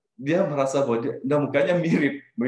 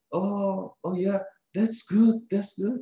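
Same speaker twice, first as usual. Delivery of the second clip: higher, slow, breezy act of being fine; fake cheerful; 8 semitones higher, much slower than usual. To his scary coworker at night, faking a carefree cheerful tone. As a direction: sound cheery so the man with the knife stays calm. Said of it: "saya pura-pura suara saya seperti tidak ada apa-apa".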